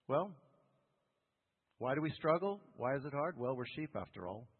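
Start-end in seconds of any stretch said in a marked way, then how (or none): none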